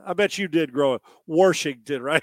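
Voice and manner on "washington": "Washington" with an r sound, said as Warsh-ington; Warshington. Retold or imitated. Co-host teased for saying "Washington" as Warshington. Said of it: "Some people like to say Washington".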